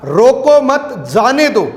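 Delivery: "रोको मत" run together, break, then "जाने दो"; group मत with रोको, then let "जाने दो" drop; pause after मत. Shown as a demonstration. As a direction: tell them to let go: "रोको मत" as one phrase, pause before "जाने दो". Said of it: "उस आदमी को जाने दो".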